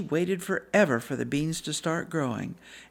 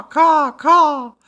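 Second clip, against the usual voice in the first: loud, high crow cawing; crow caws; 11 semitones higher, 14 dB louder than usual. Acted. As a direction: caw like a shouting crow. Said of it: "shouting their caw".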